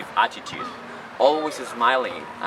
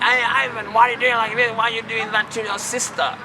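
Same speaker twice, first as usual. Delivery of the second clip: scolding mom; 10 semitones higher than usual. Retold or imitated. Mom running in to scold Ivan, high mock scolding. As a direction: pitch up into a shocked mom telling off her boy. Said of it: "saying, like".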